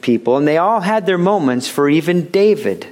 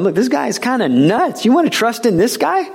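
higher-pitched scoffing disbelief; incredulous listeners; 8 semitones higher than usual. Acted. Doubters reacting to Jesus' claims, incredulous. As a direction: pitch it up, as if doubting his sanity. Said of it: "these who are listening to start going".

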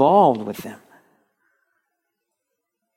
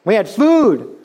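high-pitched mock whining about the good old days; mock complaining; 10 semitones higher, 3 dB louder than usual. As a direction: exaggerate the Israelites' grumbling, pitched high. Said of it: "they kept complaining".

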